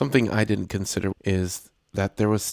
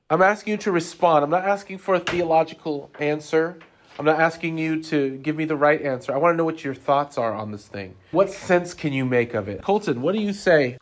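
loud teacher voice; 8 semitones higher, 4 dB louder than usual. Real, not acted. Teacher calling on class.

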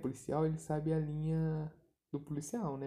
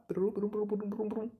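higher brum brum engine noise; playful vroom of a driving car; imitated car engine; 6 semitones higher than usual. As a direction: make the car's motor sound with your mouth.

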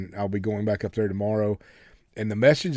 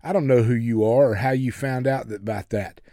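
ordinary delivery

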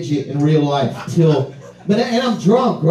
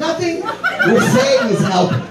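retold yell; 9 semitones higher than usual. Drunk man defending himself to the angry guy, mock yell. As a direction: act out a hapless yelled excuse. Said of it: "and I yell out".

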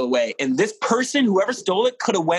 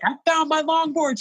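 excited; 13 semitones higher than usual. Retold or imitated. Telling manager the news.

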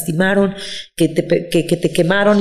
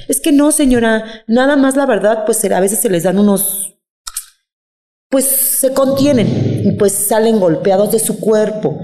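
higher, slow, drawn-out official excuse; social worker; 4 semitones higher, slower than usual. Retold or imitated. Social worker brushing off a worried relative, mock-patient excuses. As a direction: imitate a social worker explaining things away. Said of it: "me dice la chava, una, una trabajo social".

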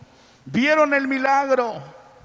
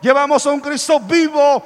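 loud, rising preacher's call; full-force preaching; 3 semitones higher, 8 dB louder than usual. Acted. Preacher stirring the congregation, loud and rising. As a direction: fire up the congregation with each line.

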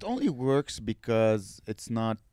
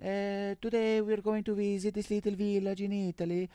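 mock Italian accent; 6 semitones higher than usual. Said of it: "She's like".

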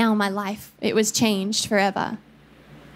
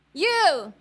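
high, mocking call-out; mock accusation; 8 semitones higher than usual. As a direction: jeer at him up in the tree. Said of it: "Sounds like a rap".

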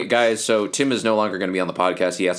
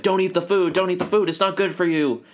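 put-on high frantic kid warning; crazy rant; 11 semitones higher than usual. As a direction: rant like a panicked boy. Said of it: "crazy rants".

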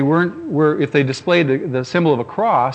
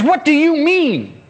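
much higher, slow, puzzled question; confused girl; 13 semitones higher, 3 dB louder, slower than usual. Retold or imitated. Girl puzzled by his answer, slow and high. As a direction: stretch it out, baffled.

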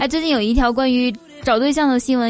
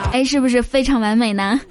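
ordinary delivery

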